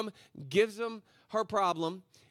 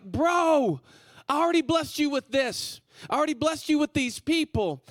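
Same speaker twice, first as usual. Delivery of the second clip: loud, raised-pitch mock exasperation; exasperated; 6 semitones higher, 5 dB louder than usual. The Lord answering those asking for more, loudly exasperated. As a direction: push it out like a fed-up friend.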